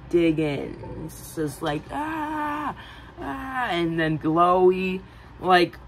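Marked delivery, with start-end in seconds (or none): 1.9-3.7 high mock cries of pain; high pained cry. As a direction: cry out as needles go in. Needles digging into skin.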